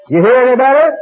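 high, loud showman yell; mock yell. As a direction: yell the question to the crowd. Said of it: "he yelled".

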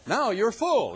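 a sneering jab at their pride; sarcastic. Retold or imitated. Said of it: "he says sarcastically".

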